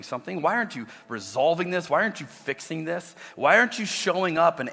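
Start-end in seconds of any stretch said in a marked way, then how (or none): none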